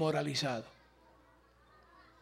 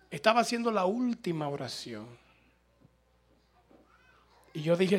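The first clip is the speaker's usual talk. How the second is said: ordinary talk, nothing marked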